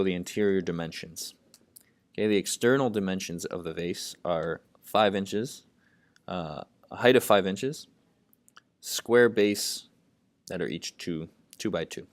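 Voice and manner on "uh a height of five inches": usual speaking voice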